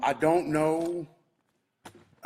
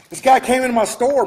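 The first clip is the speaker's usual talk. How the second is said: louder, higher, outraged; indignant outburst; 6 semitones higher, 6 dB louder than usual. Real, not acted. Owner telling viewers about the intruder in his store, outraged.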